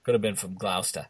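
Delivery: Gloucester with a soft Boston "-stah"; Boston accent, no final R. Acted. Guessing where the pilot was from, Boston accent. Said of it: "episode in our Boston accents".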